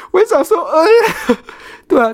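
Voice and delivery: high, slow, laughing sigh; can't stop laughing at the memory. Real, not acted. Laughing about the clueless coworker while retelling it.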